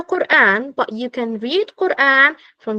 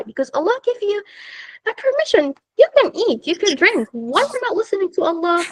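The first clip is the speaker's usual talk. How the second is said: high-pitched, insistent pleading; exasperated teacher reasoning with stubborn students; exasperated remonstrance; 8 semitones higher than usual. Acted. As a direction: plead the case, pitch raised well up. Remonstrating with girls who fast anyway.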